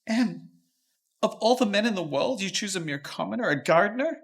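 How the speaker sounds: furious father raising his voice at his daughter; angry outburst. Acted. Father scolding daughter over her gardener, angry shout. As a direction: let him rip into her, loud and outraged.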